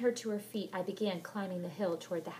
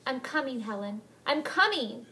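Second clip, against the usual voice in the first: crying out; 5 semitones higher, 8 dB louder than usual. Acted. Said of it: "she cried".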